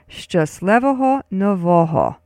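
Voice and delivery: "Szczęśliwego Nowego" as shchus-LEH-vo-ho no-VO-ho; joined-words demo. Shown as a demonstration. Teacher joins the words, "Szczęśliwego Nowego" modelled in Ukrainian. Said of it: "put it together with the first word".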